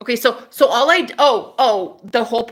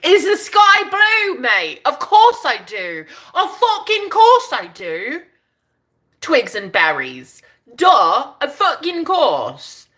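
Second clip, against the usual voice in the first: slow, high-pitched British exclaiming; reply in a drawn-out, hammed-up British accent; 5 semitones higher, 3 dB louder, slower than usual. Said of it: "have his British accent on".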